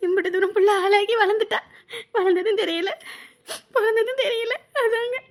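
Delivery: high voice breaking into sobs; halting words between tearful breaths; crying. Acted. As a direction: sob out a mother's bittersweet wonder. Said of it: "நம்ம அழுதோம்னா பிள்ளைங்களும்".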